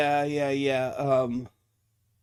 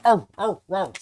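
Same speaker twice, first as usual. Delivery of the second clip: short loud choppy barks; wrong hound bark; 5 semitones higher, 7 dB louder than usual. Shown as a demonstration. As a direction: bark three quick times, the wrong way. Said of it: "and it's not a".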